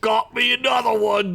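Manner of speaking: high, pleased goblin crowing; a goblin who just slurped a spider, delighted; gleeful goblin voice. Acted. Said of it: "Spank said with a smile".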